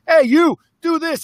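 high, loud bossy order; pushy command. Retold or imitated. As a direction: bark it like a pushy boss. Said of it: "It's never pushy".

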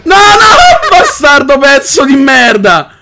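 high yelling through laughter; real laughing yell at the game; panicked laughing shout. Real, not acted. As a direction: scream and laugh as the creeper hits. Creeper attacks, panic.

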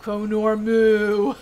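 loud, drawn-out chant of the name; slow, booming mock proclamation; theatrical name call. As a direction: hold each syllable long and loud.

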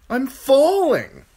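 high, loud, slow wail; panicked boy. A narrator's reading. Bran plunging toward the earth, panicked wail. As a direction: cry out in fear.

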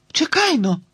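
high scared shout; frightened cry. Acted. Girl crying out in fright at the chasing dogs. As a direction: cry out in alarm. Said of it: "перелякано крикнула Тіна".

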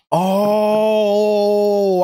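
long held "oh" of realization; drawn-out "oh". Real, not acted. Sudden realization while watching.

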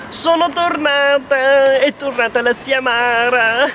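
ordinary delivery

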